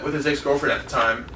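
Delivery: ordinary talk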